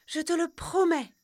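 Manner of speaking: put-on high, loud young girl's voice; girl's promise. Margot promises the dove, high and earnest. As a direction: promise solemnly like a young girl. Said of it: "dit Margot".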